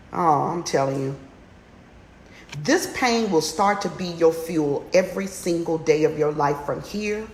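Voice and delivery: ordinary talk, nothing marked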